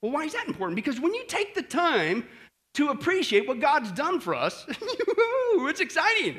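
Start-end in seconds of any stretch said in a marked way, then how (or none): 4.7-6.4 high, drawn-out gleeful burst; overjoyed, slow squeal of excitement; excited whoop. Real, not acted. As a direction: laugh it out, high and thrilled. Preacher whoops with joy over blessings.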